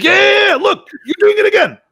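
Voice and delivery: very high, loud mock triumph; imitating excited shouting. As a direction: shout it high, like catching someone red-handed. Imitates friend shouting he caught him.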